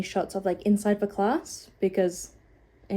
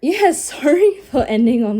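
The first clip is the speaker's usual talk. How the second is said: laughing; 5 semitones higher, 9 dB louder than usual. Real, not acted. Laughs apologizing for negative ending.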